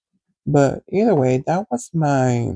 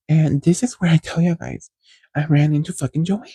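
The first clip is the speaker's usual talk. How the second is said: usual speaking voice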